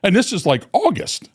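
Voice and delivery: high, pleading protest. Retold or imitated. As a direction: whine it's too hot out here. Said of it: "I said".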